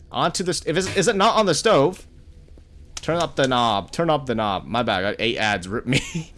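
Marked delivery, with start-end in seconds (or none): none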